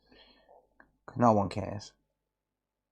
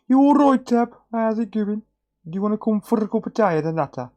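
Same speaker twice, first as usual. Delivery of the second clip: put-on cheery voice; 9 semitones higher, 3 dB louder than usual. Mock greeting to donor Tab.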